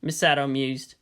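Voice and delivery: ordinary delivery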